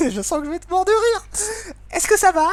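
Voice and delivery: put-on high girl voice. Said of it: "faire des voix un petit peu particulières".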